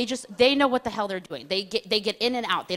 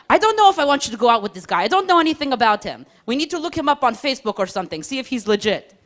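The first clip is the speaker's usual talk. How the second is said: higher, louder, fast worried nagging; anxious mock-parent fussing; 4 semitones higher, 4 dB louder than usual. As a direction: fret like an overprotective mom. Roommate soldier fussing like a mother.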